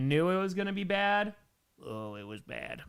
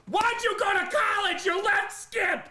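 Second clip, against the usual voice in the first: high, loud outraged yelling; angry yell at the screen; 4 dB louder than usual. Retold or imitated. Kid in the theater yelling at Frankie Muniz, outraged. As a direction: scold the character for leaving the dog. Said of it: "I was like".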